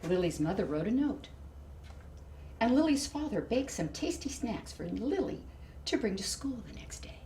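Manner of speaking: ordinary delivery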